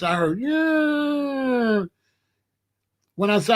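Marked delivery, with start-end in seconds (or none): 0.4-1.8 much higher, drawn-out angel voice; otherworldly yeah. Retold or imitated. Angel answering a dying man, much higher ghostly yeah. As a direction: float the word like a spirit.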